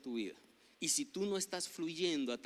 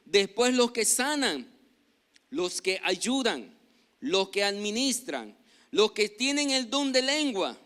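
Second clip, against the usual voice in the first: loud, higher, slow declamation; scripture read aloud; 5 semitones higher, 9 dB louder than usual. Real, not acted. Pastor reading scripture to the congregation, loud slow proclamation. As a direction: proclaim the verse, drawing the words out.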